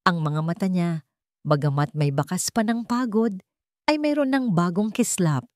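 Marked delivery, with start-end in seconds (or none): none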